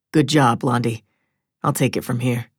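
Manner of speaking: low, easy-going man's voice; husband's voice. Acted. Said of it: "Joe tossed a towel onto the floor and said".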